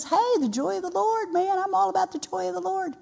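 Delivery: very high, excitable comic voice; hammed-up, high-pitched joyful hollering; Goober. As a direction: play a gleeful songwriter in a high, goofy pitch. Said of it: "a little bit like Goober".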